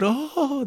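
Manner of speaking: imitated high, drawn-out "oh"; long high moan of a shocked crowd; horrified crowd gasp. Crowd watching the hanging, mock horrified gasp. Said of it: "they're all horrified".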